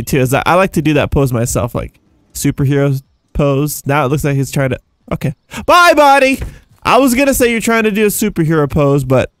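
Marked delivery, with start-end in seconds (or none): 5.7-6.4 calling goodbye. Real, not acted. Calling off a flying character.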